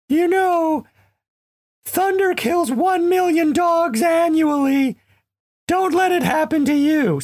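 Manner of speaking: very high, shouted pet voice; Django's dog voice. Retold or imitated. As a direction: pitch way up for the dog's silly warning. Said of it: "that sort of high pitch became his voice".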